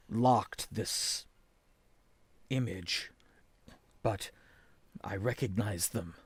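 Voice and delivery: low, hushed character voice; subdued, absorbed seer. Acted. Game master voices Viraz explaining his vision.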